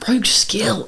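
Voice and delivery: a soft whisper, thinking aloud; musing in a whisper; whispered to herself. Real, not acted. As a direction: whisper it while she ponders the question. Muttering the question to herself.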